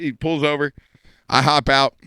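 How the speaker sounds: ordinary talk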